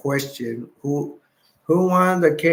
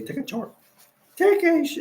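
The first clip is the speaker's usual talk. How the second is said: high mimicked ex-wife voice; mock the ex-wife's nagging; imitating ex-wife. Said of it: "ex-wife to say".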